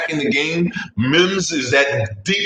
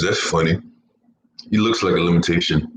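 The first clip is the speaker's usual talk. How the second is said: ordinary talk, nothing marked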